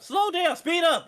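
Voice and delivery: high, loud yelled orders; mock shout. Friend yelling at the speeding driver, hammed-up shout. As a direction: bark the two options at the driver. Acted car argument, shouting options.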